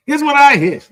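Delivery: loud, high-pitched impression; Lori Wickens. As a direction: burst in loudly as the local storyteller. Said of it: "and he just went".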